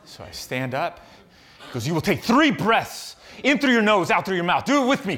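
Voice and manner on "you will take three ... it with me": loud, higher drill-coach orders; voicing the coach's firm commands, loud. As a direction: imitate a stern trainer giving orders.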